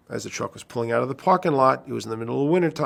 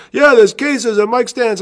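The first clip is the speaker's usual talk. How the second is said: put-on slow, high and loud old man; old custodian; 12 semitones higher, 9 dB louder, slower than usual. Custodian insisting about the mic stands, high slow voice. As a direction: drag it out high like the old guy. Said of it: "He goes".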